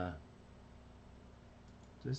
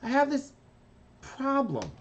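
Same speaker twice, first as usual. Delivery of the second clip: much higher cartoon kid voice; high little kid cartoon voice; Carbonate voice. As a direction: confess it like a small kid. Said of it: "He says".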